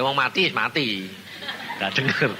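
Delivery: ordinary delivery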